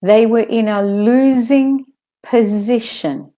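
"losing position" with buzzing z in both words; careful model sentence, z in "losing position"; voiced Z's. Shown as a demonstration. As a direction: say "losing position" as LOO-zing puh-ZISH-un. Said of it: "saying the Z's properly".

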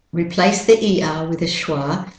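usual speaking voice

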